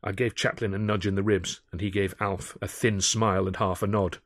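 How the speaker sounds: plain reading voice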